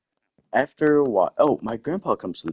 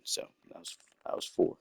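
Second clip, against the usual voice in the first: muttered count; 11 dB quieter than usual. Real, not acted. Counting items under his breath.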